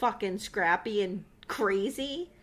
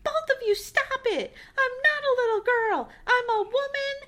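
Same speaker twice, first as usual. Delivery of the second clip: high shrieking tantrum, crying at the end; mock screaming; 13 semitones higher, 4 dB louder than usual. Laura screaming at both men, high mock shriek. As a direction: shriek it like a stomping teenager. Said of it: "starts screaming".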